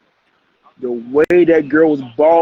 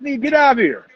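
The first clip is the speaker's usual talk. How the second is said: dismissing; 8 semitones higher, faster than usual. Real, not acted. Host throwing out an uninvited guest.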